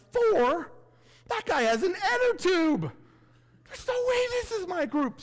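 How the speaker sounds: shocked disbelief. Retold or imitated. At the race start, eyeing her group in shock, high incredulous cry. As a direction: recoil at the sight of the guy with an inner tube. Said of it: "I was like".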